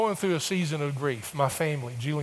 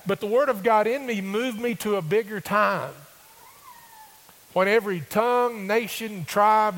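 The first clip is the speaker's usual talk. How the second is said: preaching at full force; 6 semitones higher, 5 dB louder than usual. Acted.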